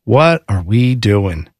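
drawn-out, low WHAT ARE WE DOING; slow exasperation. Real, not acted. Host to co-host over computer noise, slow exasperation. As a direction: space out each word of the question slowly. Repeats question, slower for emphasis.

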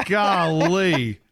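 normal talking voice

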